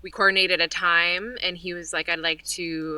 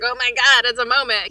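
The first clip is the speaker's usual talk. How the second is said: high and loud with excitement; thrilled; 6 semitones higher, 7 dB louder than usual. Retold or imitated. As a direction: gush about the big moment. Retelling her own thrilled reaction.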